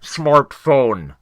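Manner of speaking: slow, overdone dubbed-in word; mock ADR dub. Mimicking Jeremy's scolding friend, mock ADR insert. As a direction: make it stick out like a bad overdub. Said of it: "they A D R in, uh, the word smartphone".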